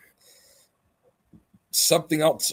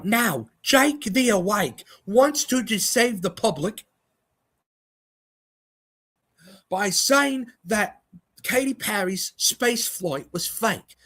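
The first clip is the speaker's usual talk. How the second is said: impression of Simon Scam; 8 semitones higher than usual. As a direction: lecture like a smug know-it-all, pitched up. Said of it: "that just now was my impression of, um, Simon Scam".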